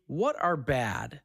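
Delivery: usual speaking voice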